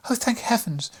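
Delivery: high relieved cry of the nurse. A narrator's reading. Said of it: "cried the nurse".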